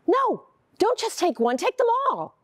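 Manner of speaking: high-pitched panic-buying order; frantic urging. Acted. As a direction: urge him to grab everything. Wife frantically stocking up on food.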